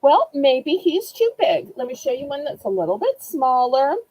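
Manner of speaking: ordinary delivery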